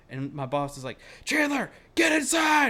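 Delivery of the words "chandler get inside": high, slow, loud holler; drawn-out acted yell of command; imitated boss's shouted order. Imitates boss ordering teller inside.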